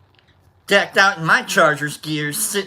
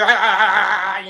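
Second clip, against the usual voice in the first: mock fake laugh; 5 dB louder than usual.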